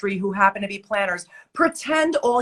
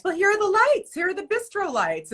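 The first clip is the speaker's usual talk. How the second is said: much higher, sing-song mock planner voice; mock chirpy planner; 12 semitones higher, faster than usual. Mocking the planner who shows off bistro lights, high mock chirp. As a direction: mimic the clueless planner proudly pointing out the lights.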